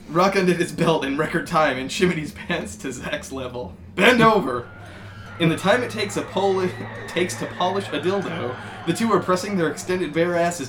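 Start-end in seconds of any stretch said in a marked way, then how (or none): 3.9-4.7 higher, loud order; barked command. Acted. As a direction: bark the order. Chris commands Rock.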